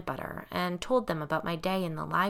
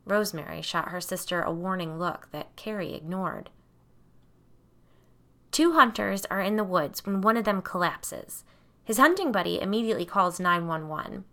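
ordinary delivery

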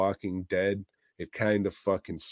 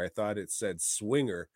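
usual speaking voice

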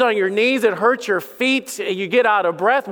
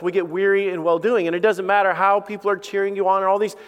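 ordinary talk

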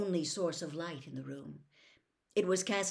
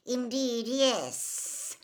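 louder, higher, drawn-out sinister voice; cold-voiced villain; 4 semitones higher, 5 dB louder, much slower than usual. A narrator's reading. Cold master answering his servant, sinister and emphatic. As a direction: relish it as the icy master. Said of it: "said the voice".

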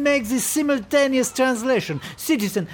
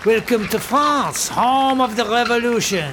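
put-on French accent; 2 semitones higher than usual. French host greeting.

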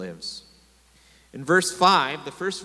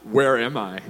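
blurted out; 4 semitones lower, 4 dB louder than usual. Retold or imitated. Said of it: "just blurt out".

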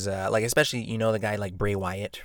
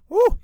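high, loud delighted whoop; real delighted whoop after a sip; 10 dB louder than usual. Real, not acted. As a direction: whoop with pleasure. Cheer after tasting cider.

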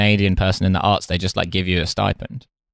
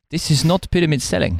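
higher, put-on South African salesman; Elon Musk; 5 semitones higher than usual. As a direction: reassure the customer in a mock South African accent. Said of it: "cold calling salesman for Tesla".

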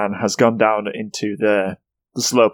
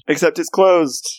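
normal talking voice

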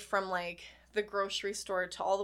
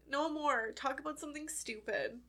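crying a little; 5 semitones higher, 3 dB louder than usual. Real, not acted. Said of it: "you're already crying".

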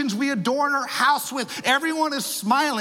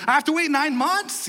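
pitched-up, indignant question; mock incredulity; 3 semitones higher than usual. Joseph learning he must wait for his wife, mock incredulous. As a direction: jump up in mock disbelief. Joseph's acted complaint at waiting.

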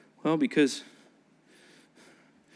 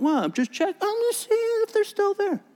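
rising squeaky higher voice; sheepish excuse. Retold or imitated. Preacher acting himself caught with old shoes, sheepish high voice. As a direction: caught out and playing innocent.

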